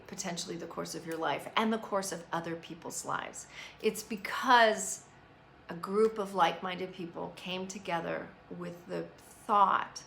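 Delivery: ordinary talk, nothing marked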